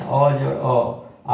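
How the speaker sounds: slow, अ जोड़ अ stretched so each अ counts as one beat; slow two-beat demo. Shown as a demonstration. Teacher showing students that a long vowel takes two beats, slowly. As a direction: sound out both अ to show two मात्रा. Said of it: "दो मात्रा है ना".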